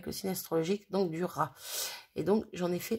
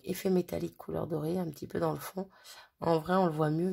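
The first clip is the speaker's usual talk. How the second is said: ordinary delivery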